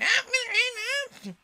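shrill high scream, very loud; high furious royal scream; screaming Queen. A narrator's reading.

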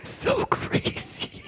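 high, whispery mock sobbing; exaggerated breathless blubbering; hammed-up crying. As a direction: squeak the words out slowly through fake sobs. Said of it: "I hear some crying".

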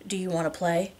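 soft, intimate invitation; low voice. A narrator's reading. Husband leans in close to his wife, low voice. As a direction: keep it down, an understated seductive ask. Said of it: "his voice low".

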